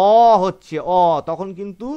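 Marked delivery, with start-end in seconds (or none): 0.1-0.8 অ said much louder and held; stressed sound. Shown as a demonstration. Teacher correcting students, stressing অ loudly. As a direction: lean hard on অ. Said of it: "ওর উচ্চারণটা".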